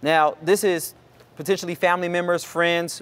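ordinary talk, nothing marked

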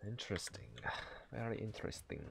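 intrigued whisper. Real, not acted. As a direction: breathe it like a secret. Muses over troll lore.

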